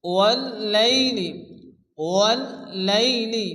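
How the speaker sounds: ওয়াল্লাইলি very slowly, syllable by syllable; slow, measured ওয়াল্লাইলি with a held double "l"; tajweed model reading. Shown as a demonstration. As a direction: stretch ওয়াল্লাইলি out for pupils to copy. Teacher models recitation word by word.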